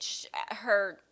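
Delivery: ordinary delivery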